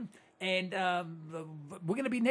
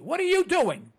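louder, higher holler at the listeners; 6 semitones higher, 5 dB louder than usual. Real, not acted. Host hollering at his radio listeners. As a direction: yell it at them to wake them up. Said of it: "I'm hollering at you".

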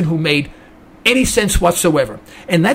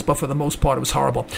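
quieter, lower repeat; 5 semitones lower, 7 dB quieter, faster than usual. Real, not acted. Host repeating his verdict on the debate, softer and lower.

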